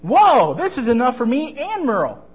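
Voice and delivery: put-on high, loud, amazed boy; excited. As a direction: whoop at the big loaf. Marvy sees big bread.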